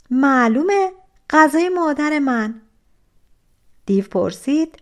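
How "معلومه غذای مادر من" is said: cocky. Acted. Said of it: "دست به کمر گذاشته بود".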